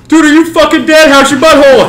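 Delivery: high, loud mock shout; mock yell. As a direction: yell it high across the store, hammed up. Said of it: "she's like".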